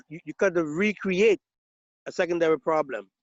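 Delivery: ordinary delivery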